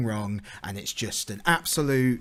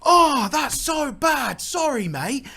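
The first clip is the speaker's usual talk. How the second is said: high, loud, drawn-out British sympathy; hammed-up British passer-by, pitched way up; very British bloke; 13 semitones higher, 5 dB louder, much slower than usual. Random bloke consoling a stranger over smashed eggs, exaggerated British sympathy. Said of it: "he literally sounded that British as well".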